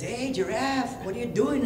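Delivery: high, drawn-out call; rabbit character. Acted. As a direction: play a little rabbit hailing a friend, high-pitched. Rabbit calls out to giraffe.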